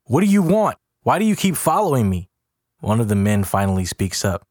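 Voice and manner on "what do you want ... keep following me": high, agitated demand; high-pitched frantic yell; freaked-out yelling. A narrator's reading. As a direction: confront your pursuers in a panic. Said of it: "freaking out and yelling".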